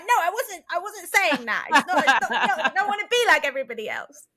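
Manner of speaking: high, indignant mock protest; riled up. Partner defending against a household insult, riled up. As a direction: protest fast and high, playfully wound up. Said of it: "like, riled up".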